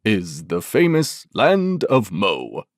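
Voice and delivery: deep, slow proclamation; solemn, impressive. A narrator's reading. Bumpy Man names the famous land, slow solemn deep voice. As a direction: announce it grandly, taking your time. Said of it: "he announced in a solemn, impressive voice".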